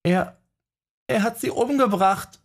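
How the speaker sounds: high, tearful sniffling voice; snotty crying. A narrator's reading. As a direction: sniffle the words out through tears. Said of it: "Sie schniefte und antwortete kaum vernehmlich". (schniefend, kaum hörbar weinend)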